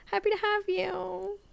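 cartoonish high welcome; mock high welcome. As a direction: repeat it in a silly squeaky voice. Repeated in a put-on voice.

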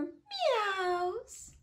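high, long-drawn mews; playful high kitty sound; cat meow. Acted. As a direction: stretch the word into a cat's cry. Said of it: "says cat".

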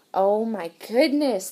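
plain reading voice

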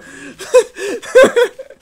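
squealing high laugh; high helpless laugh. Real, not acted. Laughs at the chaos.

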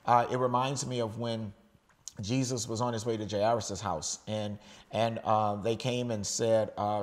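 ordinary talk

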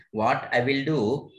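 normal talking voice

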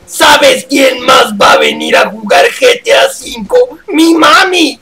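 hammed-up gym bro shouting; Muscle Man voice. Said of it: "Ya no me salió, güey, la voz de Musculoso".

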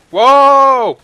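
loud high drawn-out whoa; surprised whoa. Real, not acted. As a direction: react in sudden surprise. Surprise in game.

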